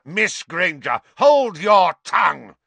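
loud, slow, cutting rebuke; angry rebuke. Acted. Snape cutting off the student, a loud slow angry rebuke. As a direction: silence the student with force.

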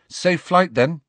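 bright send-off. A narrator's reading. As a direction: cheer her on her way. Harry sends owl off.

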